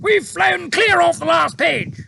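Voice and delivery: high, loud blustering officer; outraged officer. A narrator's reading. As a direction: Air Force chief shouting at the pilot, outraged. Air Force head voiced.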